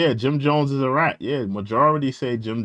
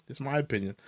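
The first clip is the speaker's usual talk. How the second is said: Just my opinion, much softer; quiet aside; 10 dB quieter, faster than usual. Real, not acted. Streamer repeating his verdict, softly under his breath. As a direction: mutter Just my opinion as an afterthought.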